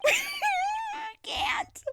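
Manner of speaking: high, loud squeal of laughter; real high-pitched laughing fit; helpless laughter. Real, not acted. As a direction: squeeze the words out through laughing. Cracking up at the cutscene.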